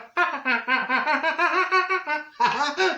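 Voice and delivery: loud, high burst of laughter; big laugh. Real, not acted. [name] laughs at picturing herself driving a tanker.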